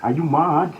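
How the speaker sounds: loud, high "are you mad"; incredulous shouting. Real, not acted. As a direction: yell in disbelief. Shouting at coughing friend.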